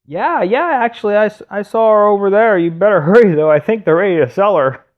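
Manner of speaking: loud, high-pitched tip-off; informant townsperson. Retold or imitated. Townsperson gives husband an urgent lead.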